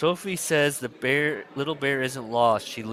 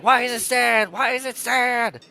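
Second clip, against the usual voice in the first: high, frantic demand; hammed-up hysterical teacher yell; mock shouting; 13 semitones higher, 5 dB louder than usual. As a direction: wail the question, pitched way up. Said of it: "Kathy shouted".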